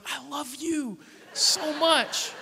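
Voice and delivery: high, very slow, drawn-out love declaration; gushing adoration. Retold or imitated. Husband admiring wife for pranking his brother, gushing adoration. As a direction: swoon over each word, hammed up.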